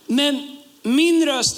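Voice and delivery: words stretched out slowly; slow down to mark the point; slow emphasis. Acted. Preacher starting a new point.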